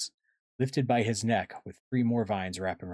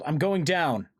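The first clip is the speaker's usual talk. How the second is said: high, urgent declaration; yelled; 8 semitones higher, 5 dB louder than usual. A narrator's reading. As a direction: call out as you dive in. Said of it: "Tobias yelled".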